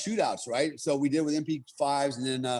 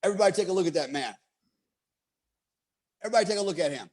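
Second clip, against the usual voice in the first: instructor's announcement; 4 semitones higher, much faster than usual. Retold or imitated. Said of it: "next words out of his mouth were".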